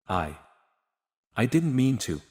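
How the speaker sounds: halting, broken-up start; a mild stammer on the first word. Acted. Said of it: "Sophia stammered".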